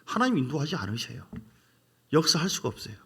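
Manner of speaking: normal talking voice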